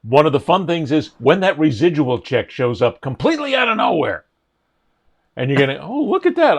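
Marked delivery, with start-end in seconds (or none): 5.8-6.6 high-pitched mock delight; pleasant surprise. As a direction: act out finding an unexpected windfall. Acted delight at surprise cheque.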